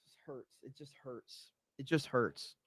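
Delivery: hammed-up pain, barely voiced; mock anguish, near silent. Streamer pretends the poll results wound him, barely voiced. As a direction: groan the words out as if wounded, very soft.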